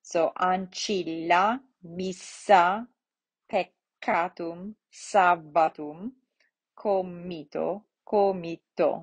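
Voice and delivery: slowly, each doubled letter held in "ancilla, missa, peccatum, sabbatum, comito"; small pause inside each pair in "ancilla, missa, peccatum, sabbatum, comito"; both double consonants sounded. Shown as a demonstration. Teacher showing double consonants, "ancilla, missa, peccatum, sabbatum, comito" with both sounded. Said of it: "you're going to pronounce both of them".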